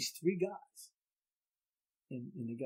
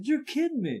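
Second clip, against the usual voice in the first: disbelief; 12 semitones higher, 5 dB louder than usual. Retold or imitated. Lineman's disbelief.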